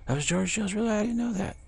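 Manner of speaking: amazed disbelief. Retold or imitated. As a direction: play it astonished at the name. Retold disbelief on learning name.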